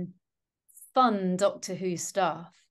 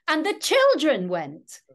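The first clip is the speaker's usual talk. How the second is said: high, loud, exaggerated stress on "children"; mock the misplaced stress, swooping up on "children"; sarcastic correction; 8 semitones higher, 7 dB louder than usual. Fussy listener talking back to the radio, sarcastic. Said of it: "I kind of sarcastically say to the radio".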